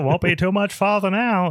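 high, hammed-up Maine old-timer voice; Judd. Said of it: "Judd just keeps going".

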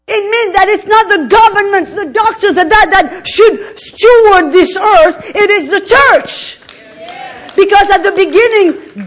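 loud, fast, high-pitched preaching; fiery preaching. Acted. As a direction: build the sermon to a fever pitch. Preaching at full force.

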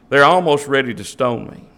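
ordinary delivery

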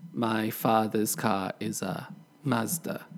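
"father's" and "car" without R sounds; British pronunciation. Shown as a demonstration. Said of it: "British English, we would say".

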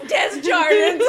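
Desjardins blurted high and loud as dez-JAR-dins; Ohioan pronunciation. Retold or imitated. Echoing co-host's Ohioan version, Desjardins as loud dez-jar-dins. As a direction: laugh out Desjardins with a hard English J. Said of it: "how an Ohioan would say it".

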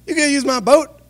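a fisherman can't believe it; incredulous. Retold or imitated. Fisherman told a stranger needs his boat, incredulous and high. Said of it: "you'd be like".

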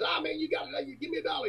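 high, soft wheedling plea; pleading midget voice. Retold or imitated. To the broke singer, a high soft wheedle. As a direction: coax him high and hushed.

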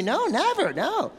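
high, put-on voice of a spouse; hammed-up innocent denial; mock denial. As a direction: deny it in an exaggerated high pitch.

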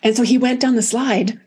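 ordinary talk, nothing marked

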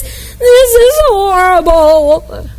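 slow drawn-out whining moan; whiny complaint. Acted. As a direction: stretch it out in a whine. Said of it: "our first reaction is".

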